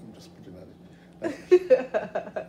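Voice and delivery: high laughing joke denial. Real, not acted. Teasing the interviewer, laughing through it. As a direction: laugh it off as a tease.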